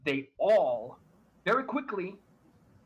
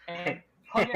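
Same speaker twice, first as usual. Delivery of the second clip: normal talking voice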